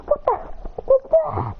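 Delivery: high, mock-startled questioning; startled yelp. Her ears are damaged, high-pitched startled yelp. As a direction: squeal it in confusion. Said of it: "But her ears are damaged".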